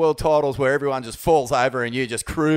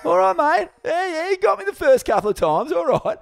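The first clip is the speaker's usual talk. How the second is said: mock meek voice; 14 semitones higher, 4 dB louder than usual. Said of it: "He's going".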